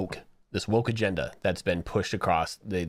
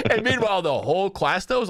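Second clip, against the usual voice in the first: laughing; 10 semitones higher, 4 dB louder than usual. Real, not acted. Laughing through narration.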